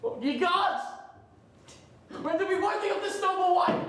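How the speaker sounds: high, ringing exclamation; awed outcry. Acted. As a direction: cry out to the heavens. Brutus overcome by Portia's devotion.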